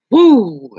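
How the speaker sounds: a sudden loud whoop; loud exclamation. Real, not acted. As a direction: let out a big woo. Exclaiming at the memory.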